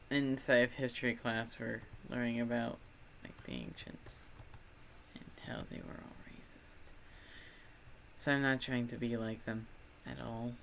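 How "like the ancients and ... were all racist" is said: whisper. Real, not acted. Whispered aside.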